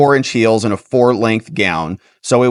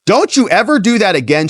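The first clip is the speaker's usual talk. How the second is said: higher-pitched, mildly indignant quote; retold warning; 8 semitones higher than usual. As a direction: act out her telling him off. Acted warning after being hit.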